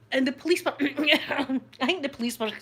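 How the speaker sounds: normal talking voice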